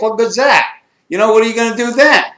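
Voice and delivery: retold friend panicking; panicked rant. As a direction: spill it out in alarm. Friend panics about his injury.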